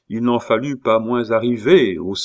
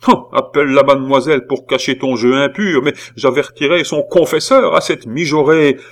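higher, louder threat; indignant scolding; 6 semitones higher, 7 dB louder than usual. A narrator's reading. Aunt threatening to warn the girl's confessor, indignant. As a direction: scold with righteous outrage.

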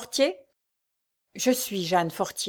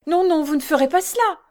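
higher, louder anguished plea; desperate protest; 8 semitones higher, 5 dB louder than usual. A narrator's reading. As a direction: protest in despair. Jeanne supplie désespérée. (protestation désespérée)

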